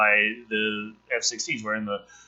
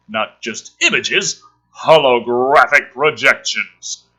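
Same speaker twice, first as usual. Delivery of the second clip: loud, urgent action read; thrilling storyteller read; dramatic script reading; 8 dB louder than usual. Acted. Reading screenplay aloud dramatically.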